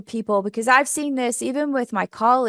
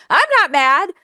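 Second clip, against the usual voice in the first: high, louder, mock-sulky I'm not mad; sarcastic denial; 8 semitones higher, 7 dB louder than usual. Teacher mocking someone who says they're not mad, high and sulky. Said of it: "heard someone say".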